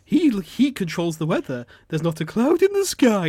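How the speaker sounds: loud, rising, exaggerated silly-woman gushing; mocking advert woman. Man mimicking the advert woman boasting, mocking. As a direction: parody her with growing, high-pitched wonder. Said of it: "she's like".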